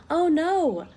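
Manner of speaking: playful storybook alarm; mock dismay. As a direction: gasp it out for the kids. Reacting to popped button.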